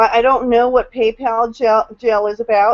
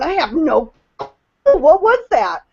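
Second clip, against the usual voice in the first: baffled; 4 semitones higher than usual. Retold or imitated. Telling the panel about a phone chime in church, puzzled. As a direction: act out being puzzled by a strange sound. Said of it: "and I'm just going".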